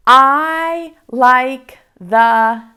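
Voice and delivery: very slow, word by word; slow model reading. Shown as a demonstration. Teacher modelling a sentence for readers, very slow. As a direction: stretch each word so kids can follow. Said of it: "Like this".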